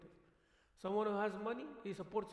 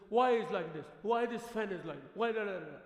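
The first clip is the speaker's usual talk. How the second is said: louder, nagging 'why' questions; mock complaint; 7 dB louder than usual. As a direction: imitate the nagging crowd. Imitating everyone's complaints.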